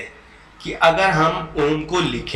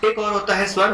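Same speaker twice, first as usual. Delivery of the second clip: ordinary delivery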